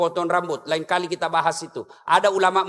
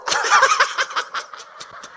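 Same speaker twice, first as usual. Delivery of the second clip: crowd laughter. Real, not acted. Audience laughter burst.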